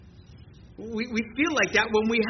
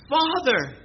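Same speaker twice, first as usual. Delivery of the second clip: crying out; 5 semitones higher than usual. Acted. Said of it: "he cries out".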